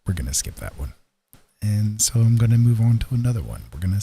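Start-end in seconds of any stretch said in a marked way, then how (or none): none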